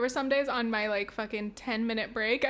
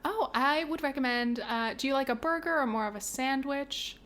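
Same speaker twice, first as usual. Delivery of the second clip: cashier voice. Pretend. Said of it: "I'll fucking do it".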